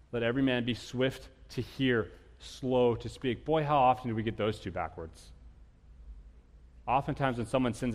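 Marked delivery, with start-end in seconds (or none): 0.1-3.4 measured, slowed recitation; slow scripture reading. Acted. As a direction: read the verse slowly and solemnly. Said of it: "I love what James says".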